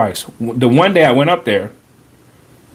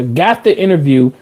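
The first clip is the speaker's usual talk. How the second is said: ordinary talk, nothing marked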